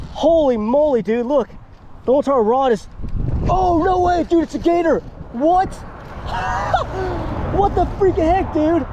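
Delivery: high startled shouts; excited shouting. Real, not acted. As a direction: yell in disbelief. Hooked a gator.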